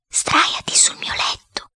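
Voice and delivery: hushed, slow, voiceless whisper; whisper in the ear. A narrator's reading. Boy secretly sending his companion to bed, whispering. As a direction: whisper it right into a friend's ear. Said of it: "gli dissi all'orecchio".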